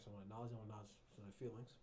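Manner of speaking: ordinary talk, nothing marked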